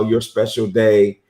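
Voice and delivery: ordinary delivery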